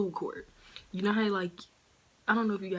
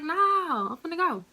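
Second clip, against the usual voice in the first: high, loud and defiant; determined no; 10 semitones higher, 5 dB louder than usual. Retold or imitated. Refusing coworkers who say let it go, loud and defiant. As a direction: push past the coworkers loudly. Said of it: "I was like".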